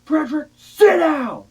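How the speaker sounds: slow, high, furious shout of a name. Retold or imitated. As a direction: bellow it like a terrified mother. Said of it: "She just says".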